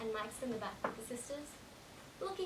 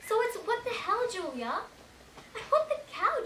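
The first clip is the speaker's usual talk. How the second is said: high, loud exasperated protest; indignant outburst; 8 semitones higher, 5 dB louder than usual. Acted. Passenger protesting to Julia at the wheel, indignant. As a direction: exclaim it, pitched sharply up.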